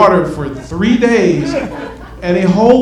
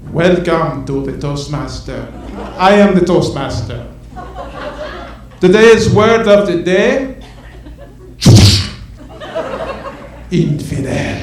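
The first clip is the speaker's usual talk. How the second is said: terrorist Toastmaster; slower than usual. Retold or imitated. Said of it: "Goes something like this".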